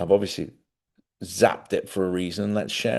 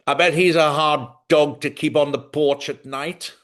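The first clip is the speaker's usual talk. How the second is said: mock posh mother, higher and louder; snappy retort; 5 semitones higher, 5 dB louder than usual. Retelling mum's jab at the lawyer, higher and louder. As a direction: snap it out like a disapproving mum. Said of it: "snapping the paper".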